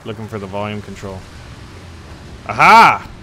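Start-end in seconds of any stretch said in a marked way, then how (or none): 2.5-3.1 loud, sudden "aha" of discovery; high-rising then falling exclamation; triumphant aha. Real, not acted. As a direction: burst out as you find it. Exclaims on finding something.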